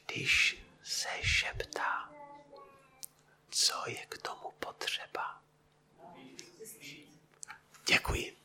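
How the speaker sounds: breathy voiceless whisper, slow. Shown as a demonstration. Preacher whispers the question to his congregation as a demonstration, whispering. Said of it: "Tak já vám trošku povím".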